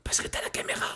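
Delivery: hushed whisper. Real, not acted. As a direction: breathe it out in a whisper. Quiet moment in horror film.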